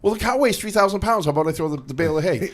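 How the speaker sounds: high-pitched mock objection; incredulous reply. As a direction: argue back in mild disbelief. Acted reply to the old German.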